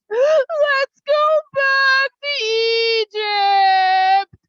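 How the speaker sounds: put-on high, loud, long-drawn childish wail; mock crying. Frightened people crying all night long, exaggerated wailing. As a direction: bawl it out slowly, hammed-up. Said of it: "the people are crying and crying".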